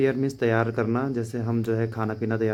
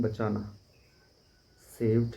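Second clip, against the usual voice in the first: normal talking voice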